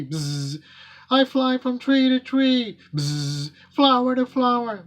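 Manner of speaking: high little bee voice with buzzing. Acted. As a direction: zip between buzzes like a busy bee. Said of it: "A bee buzzed in".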